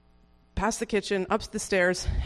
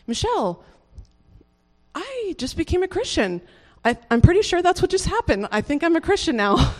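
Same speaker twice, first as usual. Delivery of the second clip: excited announcement; 10 semitones higher, 3 dB louder than usual. Retold or imitated. Retelling message to friend.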